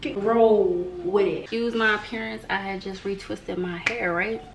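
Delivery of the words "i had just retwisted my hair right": usual speaking voice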